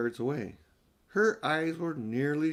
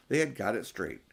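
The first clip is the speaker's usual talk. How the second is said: ordinary delivery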